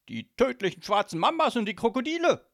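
high, loud godfather outburst; loud exclamation. Acted. Godfather answers his daughter, loud and high.

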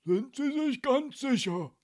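loud, slow, high-pitched suspicion; menacing older policeman, drawn out; grim questioning. A narrator's reading. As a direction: press the witness hard. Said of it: "fragte der ältere Polizist mit finsterer Miene".

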